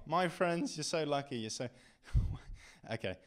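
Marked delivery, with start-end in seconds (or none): 2.3-3.2 breathless laugh. Real, not acted. Laughing break.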